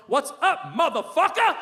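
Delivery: high, punchy greeting; brash rapper friend. Retold or imitated. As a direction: holler it down the phone like a hype rapper.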